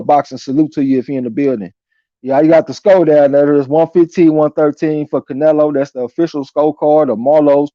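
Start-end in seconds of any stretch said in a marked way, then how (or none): none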